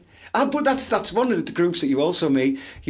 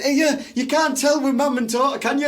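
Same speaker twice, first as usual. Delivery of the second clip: higher mocking voice; mock local woman; 7 semitones higher than usual. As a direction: ham up a woman asking. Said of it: "the one that goes".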